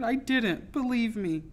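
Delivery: high, desperate insistence; pleading. A narrator's reading. Tearful girl begging the captain to believe her, pleading. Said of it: "I pleaded".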